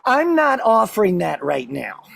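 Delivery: slow, measured, mild parental refusal; wife's firm refusal. Retold or imitated. Wife turning down husband's alone time, measured. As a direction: imitate a wife calmly turning someone down like a child. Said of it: "It's also the way she talks to me".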